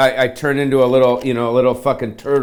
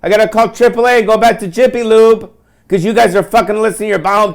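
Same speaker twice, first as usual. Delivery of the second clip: higher, louder sarcastic rant ending in a mocking beat; sarcastic complaint; 4 semitones higher, 4 dB louder than usual. Comic mock-thanking the loud car next to him, sarcastic. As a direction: sneer it at the guys in the car. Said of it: "I'm like".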